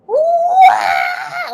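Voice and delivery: high piercing squawk, shouted out; a bird screaming at the top of its lungs; imitated bird scream. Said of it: "like that".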